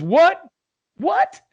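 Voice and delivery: put-on a confused old lady who can't hear; deaf old lady. Old lady to husband at traffic stop, high confused. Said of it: "With accents and all".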